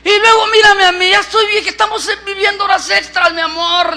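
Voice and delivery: high, emphatic wifely protest; protesta exasperada. Acted. Sara protesta por su vejez. (esposa exasperada, voz muy aguda)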